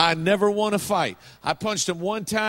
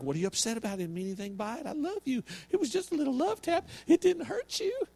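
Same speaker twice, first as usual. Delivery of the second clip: soft, high-pitched imitation of wife; imitated wife; 7 semitones higher, 5 dB quieter than usual. Wife excusing a little love tap to husband, high mock protest. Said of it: "She'd be like".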